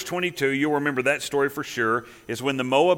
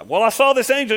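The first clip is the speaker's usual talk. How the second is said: higher, louder eager explanation; excited donkey; 8 semitones higher, 6 dB louder than usual. Retold or imitated. Donkey telling Balaam about the angel, lightly excited. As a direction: blurt it out like a breathless animal.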